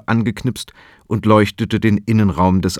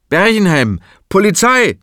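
laut ausgerufen; 10 semitones higher, 4 dB louder than usual. Acted. Polizist stellt den Mann.

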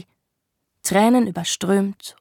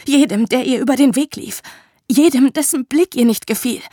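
higher and louder, fading to a whisper; anguished outburst; 5 semitones higher, 4 dB louder than usual. Acted. Woman telling the man of the victims, anguished. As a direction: pour it out, then let it die.

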